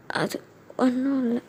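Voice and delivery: slow, soft tearful denial. Acted. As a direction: brush him off while holding back tears. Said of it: "எதுக்கு அழுதுகிட்டே இருக்க".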